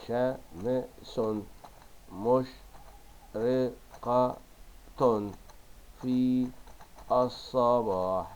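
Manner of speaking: very slow, chopped "شمس مشرقة في الصباح"; stretched, separated dictation of "شمس مشرقة في الصباح"; dictating syllable by syllable. Shown as a demonstration. Same sentence dictated while writing.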